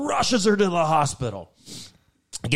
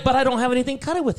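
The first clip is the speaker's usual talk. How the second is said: fast ditzy voice; 9 semitones higher, much faster than usual. Retold or imitated. Voicing Christine fast.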